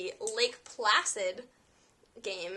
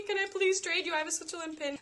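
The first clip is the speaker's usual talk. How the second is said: high pleading voice, much higher; begging coworker; 7 semitones higher than usual. Retold or imitated. Imitating the coworker begging to swap his pin, high and pleading. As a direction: plead like an eager fan. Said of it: "so he begged me".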